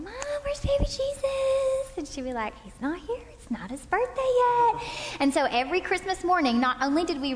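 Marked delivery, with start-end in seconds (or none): 0.0-2.0 put-on high, slow little-kid voice; childlike plea. Kids asking mom, childlike voice. 2.6-4.8 lightly voiced mom, rising high; a hint of mom. Mom teasing the kids.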